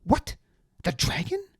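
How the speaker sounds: high shocked squeal. A narrator's reading. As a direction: gasp it in disbelief. Deliritus shocked.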